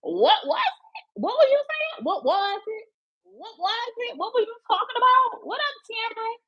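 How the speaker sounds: high mocking teasing of trash talkers; taunting. Teasing rival fans on the phone, high and mocking. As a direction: rub it in, sing-song and high. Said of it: "I was on the phone like".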